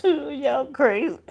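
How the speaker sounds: amused. Real, not acted. Laughing at the others.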